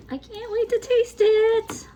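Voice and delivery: high, loud eager squeal; excited squeal. Real, not acted. Squealing eagerness before tasting.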